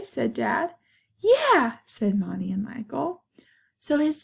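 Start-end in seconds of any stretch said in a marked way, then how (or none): 1.2-1.8 put-on high, loud, gleeful kids' "yeah"; excited cheer. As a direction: cheer it like delighted children. Children cheer the useful tail.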